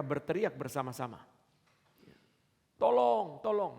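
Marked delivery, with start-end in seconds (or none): 2.8-3.8 put-on children crying for help. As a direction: call out like a child in the water. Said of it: "mereka berteriak bersama-sama".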